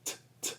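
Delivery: T-, t- as a soft voiceless puff; sounding out T. Shown as a demonstration. Speech host models the T sound for kids. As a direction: breathe out the t- sound without voice. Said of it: "learn that it starts with an T".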